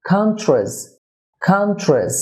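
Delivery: "কান্ট্রিজ" as KAN-chrez, TR like chr; correct pronunciation. Shown as a demonstration. Teacher models the correction, "কান্ট্রিজ" as KAN-chrez. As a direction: say "কান্ট্রিজ" with short "chrez", falling. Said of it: "চ র হয়ে থাকে".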